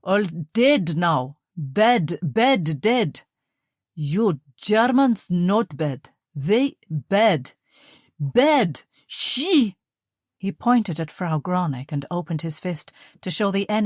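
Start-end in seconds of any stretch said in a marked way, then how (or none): 0.0-9.8 put-on slow, heavy Russian accent, each word weighed; Russian officer struggling to explain, halting; halting broken German. A narrator's reading. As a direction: force out the few words he knows. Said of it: "he struggled to find the words".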